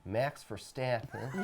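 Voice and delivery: put-on country accent. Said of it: "in your best country accents".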